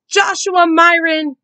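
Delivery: high, loud, slow full-name call; stern mother calling out. A narrator's reading. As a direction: call him across the yard. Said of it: "Mom called".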